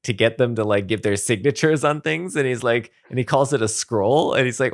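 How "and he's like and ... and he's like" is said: ordinary talk, nothing marked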